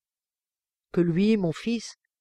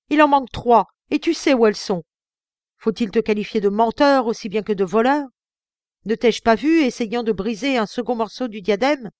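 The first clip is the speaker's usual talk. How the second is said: angry accusation; 4 semitones higher, 6 dB louder than usual. Acted. Father accusing son of theft.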